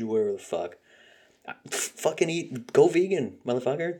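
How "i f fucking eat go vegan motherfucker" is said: higher, louder exasperated outburst; ranting yell. Real, not acted. Vlogger rants at people with diabetes, higher and louder. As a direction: bark the advice in a burst of frustration.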